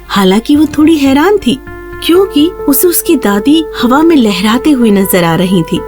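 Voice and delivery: plain reading voice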